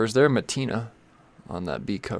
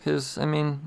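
ordinary delivery

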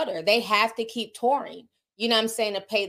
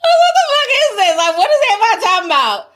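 incredulous laughing outburst; 13 semitones higher, 10 dB louder, faster than usual. Retold or imitated. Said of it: "I was like".

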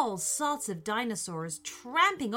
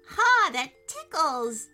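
high, giggly kitten voice; giggling; 7 semitones higher, 7 dB louder than usual. Acted. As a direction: laugh while getting splashed. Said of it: "said the little creature".

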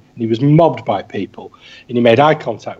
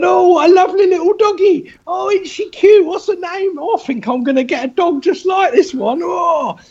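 put-on very high, gushing, overexcited little kid voice; giddy child cooing over a puppy; hyperactive five-year-old. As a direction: squeal it like a kid on a sugar high. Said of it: "exactly like the hyperactive five-year-old".

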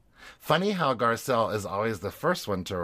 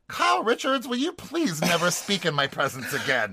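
high-pitched theatrical indignation; mock outrage; 7 semitones higher than usual. Host imagines telling Kyle off, mock outrage. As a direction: address them by full name, hammed-up and affronted. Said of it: "I would have been like".

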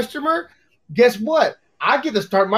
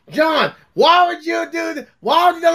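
mock railing; 6 semitones higher than usual. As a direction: imitate a brother railing at you. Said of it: "Dale would rail me".

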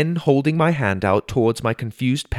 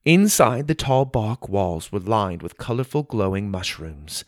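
plain reading voice

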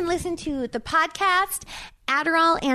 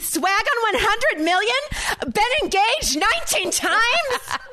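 higher, slowish New Jersey brag; Jersey accent; 8 semitones higher than usual. Pretend. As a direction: channel a reality-star Jersey accent. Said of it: "I love it when you do the Jersey accent".

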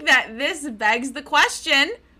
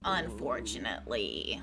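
low echo; 13 semitones lower, 11 dB quieter than usual. Pretend. Co-host echoes the regret, low mock gloom. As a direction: sink the word down in pitch.

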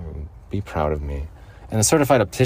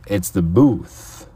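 booth voice; 6 dB louder, much slower than usual. Shown as a demonstration. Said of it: "you got a focused voice right here".

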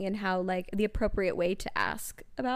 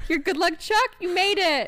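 mock cheer; 10 semitones higher, 6 dB louder than usual. Cheering friend on engagement joke.

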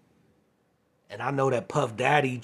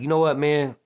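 louder, exasperated You know what, man; fed up; 4 semitones higher, 8 dB louder than usual. Retold or imitated. Telling off the manager at the end, exasperated. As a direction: done with this guy, brush him off.

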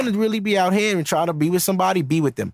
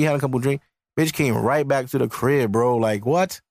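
lowered dude voice; 6 semitones lower than usual. Retold or imitated. A man griping to his friends about a date, low. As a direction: play a guy griping to his boys. Acting a guy complaining to friends.

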